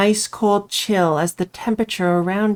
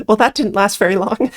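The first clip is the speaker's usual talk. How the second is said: ordinary delivery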